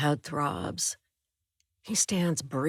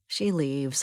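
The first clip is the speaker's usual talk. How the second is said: plain reading voice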